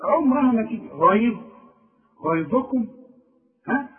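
ordinary talk, nothing marked

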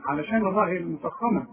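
ordinary talk

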